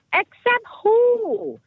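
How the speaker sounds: ordinary talk, nothing marked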